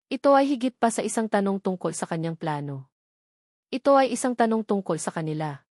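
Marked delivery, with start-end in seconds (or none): none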